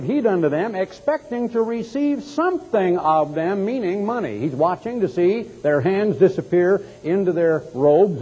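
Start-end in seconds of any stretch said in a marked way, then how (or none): none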